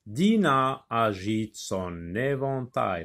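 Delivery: slow, with the liaison in "son éventail" clearly joined as "so-n-éventail"; drawn out, each syllable clear; slow articulated reading. Shown as a demonstration. Teacher showing pupils the liaison, slow and deliberate. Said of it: "avec articulation, bien articuler les mots".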